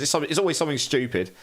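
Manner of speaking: usual speaking voice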